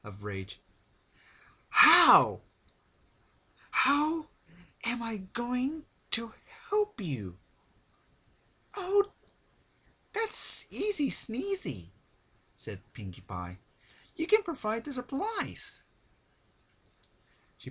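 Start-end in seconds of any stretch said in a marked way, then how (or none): 1.7-7.4 high, loud screaming then hissed; explode, then spit out the last words; fit of rage. A narrator's reading. Rarity raging at her captor Pinkie, screamed fury. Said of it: "exploding into a fit of rage". 8.8-15.8 very high, bubbly, sing-song; sweet as candy while saying something awful; cheery Pinkie. A narrator's reading. Pinkie answering Rarity's rage, high and sugary.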